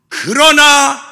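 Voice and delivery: 그러나 cried out high and loud; 그러나 rung out emphatically; stress on 그러나. Acted. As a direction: punch 그러나 as the turning point. Preacher turns to psalmist's answer.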